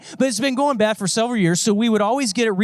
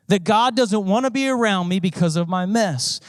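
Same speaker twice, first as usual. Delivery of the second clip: ordinary talk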